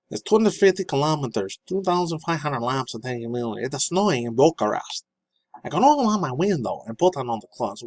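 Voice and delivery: comic put-on accent; mock accent played for laughs; Andrew Tate accent. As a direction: read it out in an exaggerated imitation of his accent. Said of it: "I think that's how the dude sounds still".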